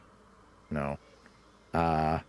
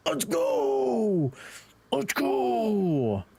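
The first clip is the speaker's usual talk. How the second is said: excited cheer; 11 semitones higher, much slower than usual. Real, not acted.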